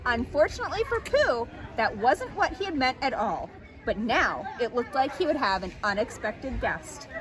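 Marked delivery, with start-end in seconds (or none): none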